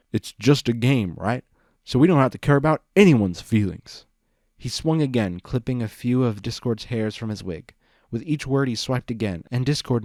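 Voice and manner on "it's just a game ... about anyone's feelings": loud, bitter throwing his words back; hurt and angry, mocking the words; bitter tearful anger. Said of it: "With tears in his eyes".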